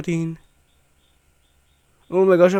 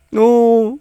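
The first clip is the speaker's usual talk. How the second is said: loud protest; 7 semitones higher, 9 dB louder than usual. Real, not acted. Monsters laughing at her.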